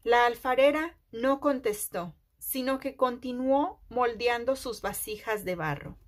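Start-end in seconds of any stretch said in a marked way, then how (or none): none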